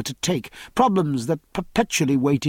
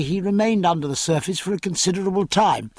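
plain reading voice

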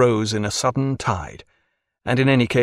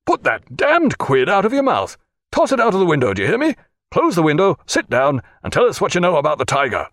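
higher-pitched, irritated commands; indignant orders; 6 semitones higher, 3 dB louder than usual. A narrator's reading. Jack tells off the tobacco spitter, indignant orders. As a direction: order him about crossly.

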